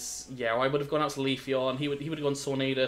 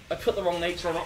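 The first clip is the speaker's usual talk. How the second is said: normal talking voice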